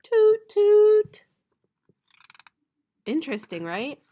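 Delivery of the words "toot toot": high, loud toot toot; loud train hoot; train whistle. Shown as a demonstration. Repeating French train sound.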